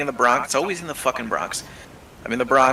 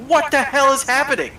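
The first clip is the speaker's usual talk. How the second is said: baffled disbelief; 12 semitones higher, 3 dB louder than usual. Retold or imitated. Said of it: "I'm like".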